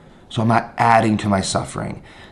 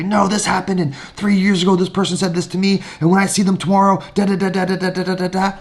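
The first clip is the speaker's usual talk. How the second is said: higher, rushing mock rant; ruminating rant; 6 semitones higher, faster than usual. Teacher acting out a grudge spiral, mildly higher. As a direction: play the runaway grudge, lightly. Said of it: "hold it with a death grip and say".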